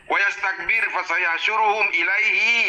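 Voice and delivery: slow chanted Arabic recital; Quranic recitation. Shown as a demonstration. Ustaz reciting the verse during the debate, slow chant. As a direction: intone the verse slowly and evenly.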